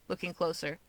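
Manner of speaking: ordinary delivery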